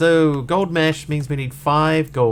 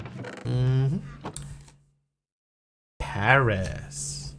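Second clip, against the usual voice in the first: usual speaking voice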